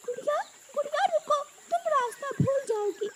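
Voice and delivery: put-on high boy-bird voice calling out; urgent calling. Brother stopping his fleeing sister, urgent calling. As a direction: call after someone running off.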